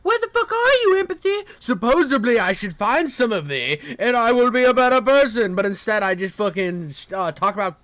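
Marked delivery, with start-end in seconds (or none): 0.0-5.6 much higher, mock calling out for empathy; hammed-up search for a lost thing. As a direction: call out for "Empathy?" in a high put-on voice. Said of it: "I'm like".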